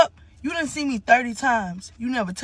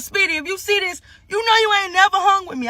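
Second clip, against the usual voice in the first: shouted callout; 6 semitones higher than usual. Real, not acted. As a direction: raise the voice and call him out, higher. Calls out Speedy directly.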